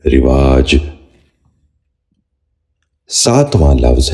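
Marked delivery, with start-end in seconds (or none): 0.1-0.8 رواج said "rivaaj", short "i" after the r; correct pronunciation. Shown as a demonstration. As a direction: open رواج with "ri", then long "vaaj". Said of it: "آپ بھی غور سے سنیے".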